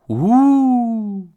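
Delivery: high, loud drawn-out ghost woo; a long swooping ghost call; spooky ghost hoot from above; ghostly woo. Acted. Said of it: "It swooped low and called".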